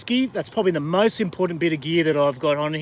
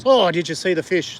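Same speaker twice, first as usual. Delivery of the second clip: surprised; 4 semitones higher than usual. Real, not acted. Sudden sight of a jumping fish.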